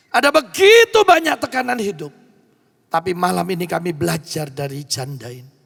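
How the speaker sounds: fervent prayer, swelling loud; pastor's closing prayer. Real, not acted. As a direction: lead the church in earnest prayer.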